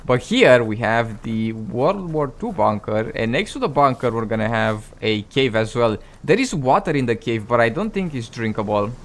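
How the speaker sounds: ordinary talk, nothing marked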